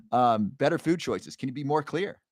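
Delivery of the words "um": ordinary delivery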